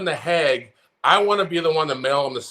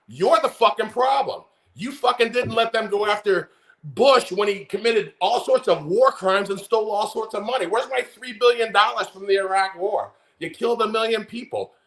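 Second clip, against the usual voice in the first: heated, higher ranting voice; worked-up rant; 5 semitones higher than usual. Real, not acted. Ranting at former Republicans on TV, fired up. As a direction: raise it up, angrier with each line. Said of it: "Sorry, getting worked up today".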